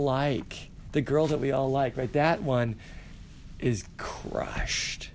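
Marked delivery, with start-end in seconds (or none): 3.6-5.1 hushed unvoiced whisper. Shown as a demonstration. Teacher whispers the sad turn to the class, hushed. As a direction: breathe it out like a secret tragedy. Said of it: "dramatic whisper on "crushed".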